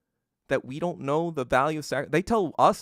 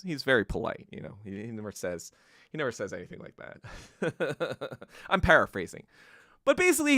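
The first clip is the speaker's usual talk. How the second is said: ordinary talk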